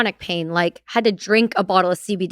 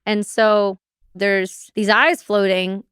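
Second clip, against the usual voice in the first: ordinary delivery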